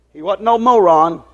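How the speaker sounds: ordinary talk, nothing marked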